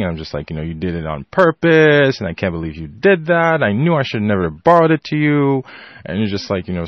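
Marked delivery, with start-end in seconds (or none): 0.6-5.6 retold indignant rant, higher in places; mock railing. Sibling accusing brother over broken headset, railing. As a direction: rail at your sibling in mild re-enacted outrage. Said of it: "start railing".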